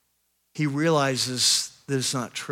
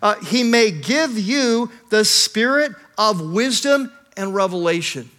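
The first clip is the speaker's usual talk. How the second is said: louder, rising sermon build to a climax; preaching at full force; 5 semitones higher, 5 dB louder than usual. Acted. Pastor to his congregation, preaching at full force. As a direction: preach it, lifting the room.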